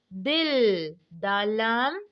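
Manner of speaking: usual speaking voice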